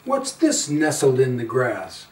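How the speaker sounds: ordinary delivery, nothing marked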